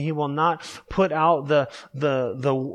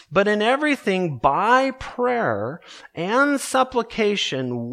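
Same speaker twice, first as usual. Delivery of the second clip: raised, unhurried preacher's scripture voice; scripture reading; 4 semitones higher than usual. Acted. Reads scripture aloud.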